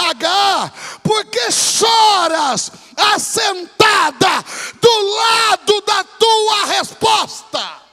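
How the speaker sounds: very loud, high, slow shouted call; booming angel. Acted. Angel asking Hagar why she weeps, slow booming shout. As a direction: stretch every word like a voice from heaven.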